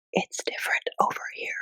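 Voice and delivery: hushed, voiceless whisper; whispered imitation. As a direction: whisper it like a secret sales pitch. Mimics recruiter's hushed pitch.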